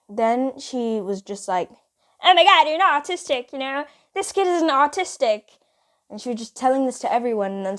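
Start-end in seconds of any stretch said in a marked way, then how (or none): 2.2-5.6 loud, high mimic of a scornful schoolmate; scornful announcement. Friend telling the whole class, loud mock scorn. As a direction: announce it to everyone, mockingly high.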